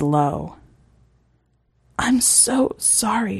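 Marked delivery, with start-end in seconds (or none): 2.0-3.4 slow unvoiced whisper; whispered thought. Reagan's silent apology as she swallows Eric, whispered. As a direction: breathe it, slow and voiceless. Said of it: "she thinks".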